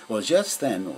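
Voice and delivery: ordinary delivery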